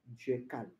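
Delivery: very low, barely voiced statement; hushed. Preacher on God's calm to believers, hushed. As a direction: drop right down, as if confiding.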